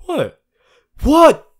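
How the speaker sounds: a sudden high, loud "What?"; startled what. Acted. Startled by the listener, a loud surprised "What?". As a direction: jolt up into a shocked shout.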